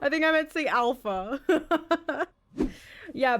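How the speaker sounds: ordinary delivery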